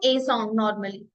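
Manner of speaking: ordinary delivery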